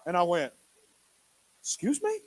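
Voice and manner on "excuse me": taken aback. Retold or imitated.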